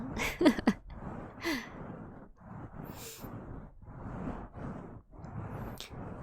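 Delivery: breathy drawn-out laugh; long whispered giggle. Real, not acted.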